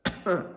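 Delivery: small, put-on polite cough. A narrator's reading. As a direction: cough politely before speaking. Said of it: "coughed politely and said".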